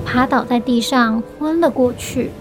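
ordinary delivery, nothing marked

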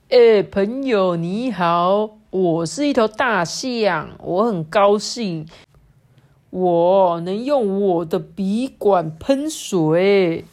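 slow, heavily stretched storybook greeting; pig posing as elephant, boastful. Acted. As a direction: announce it proudly, drawing out every phrase. (小猪假扮大象，慢慢拖长音得意地自夸)

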